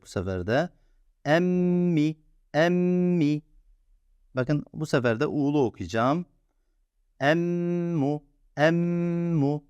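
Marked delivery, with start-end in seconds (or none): 1.2-3.4 Emmi with the mm held long and nasal. Shown as a demonstration. Teacher shows students the held nasal in Emmi. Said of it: "Tutmaya dikkat edin". 7.2-9.6 Emmu with the mm held long and nasal. Shown as a demonstration. Teacher shows students the held nasal in Emmu. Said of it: "Tutmaya dikkat edin".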